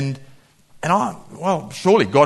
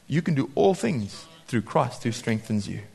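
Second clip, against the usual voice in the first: quiet affirmation; 5 semitones lower, 8 dB quieter than usual. Shown as a demonstration. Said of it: "repeat of verse".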